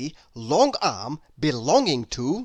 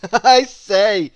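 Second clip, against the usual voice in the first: loud, amused I say; laughing; 4 semitones higher, 11 dB louder than usual. A narrator's reading. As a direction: chuckle it out good-naturedly. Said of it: "laughed Mister Happy".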